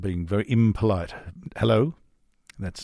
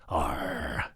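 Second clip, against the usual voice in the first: deep, quiet pirate growl; pirate arr; 7 semitones lower, 7 dB quieter than usual. Retold or imitated. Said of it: "the pirate from the, the South".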